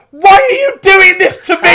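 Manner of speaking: very high, loud imitated shriek; hysterical mock wail. Actress accusing audience member before walking off, hysterical shriek. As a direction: wail it like a wronged performer.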